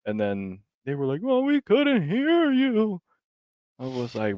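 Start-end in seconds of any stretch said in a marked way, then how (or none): 1.2-3.0 very high, slow whiny excuse; mock whiny excuse. Mocking the kids who claimed they couldn't hear, high slow whine. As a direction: squeak it out like a lame excuse.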